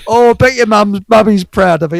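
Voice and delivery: high, loud sing-song mockery; mocking taunt. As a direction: jeer at him like a crowd of workmen. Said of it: "we were taking the piss".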